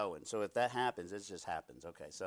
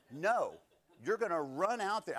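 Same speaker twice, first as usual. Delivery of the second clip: louder, higher urgent no; sharp warning; 5 semitones higher, 6 dB louder than usual. Acted. Parent stopping a toddler running into the street, loud and urgent. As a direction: snap them back from danger.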